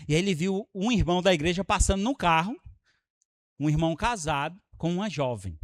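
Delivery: ordinary delivery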